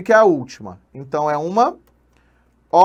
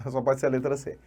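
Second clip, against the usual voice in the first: "Só pode ser a letra C" said fast and soft through a laugh; laughing repeat; 4 semitones lower, 9 dB quieter, faster than usual. Shown as a demonstration. Teacher repeating the answer to students while laughing, fast and soft. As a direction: chuckle the answer out quickly. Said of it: "repeated answer, laughing".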